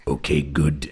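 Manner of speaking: lightly voiced dim deep guy; dopey deep voice. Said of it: "And I go".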